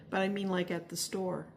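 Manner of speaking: ordinary talk, nothing marked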